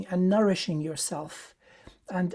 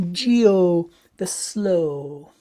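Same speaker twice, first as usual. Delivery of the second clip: drawn-out, louder, very slow delivery; really slow; 7 dB louder, much slower than usual. Shown as a demonstration. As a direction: stretch each word right out, savouring it. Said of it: "I like saying it really slowly".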